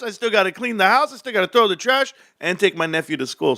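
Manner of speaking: high, worked-up outburst of disbelief; exasperated rant. Real, not acted. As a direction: vent it like a man pushed too far. Ranting about a late-night babysitting call.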